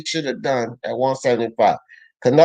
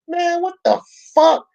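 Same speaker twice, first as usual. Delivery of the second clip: much higher, incredulous outburst; exasperated disbelief. Real, not acted. Streamer reacting to a viewer's take, in stunned disbelief. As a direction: blurt it out like you can't believe what you just read.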